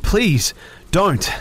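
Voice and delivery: near-screaming plea; begging. A narrator's reading. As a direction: beg her, almost screaming. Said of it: "I begged, almost screaming".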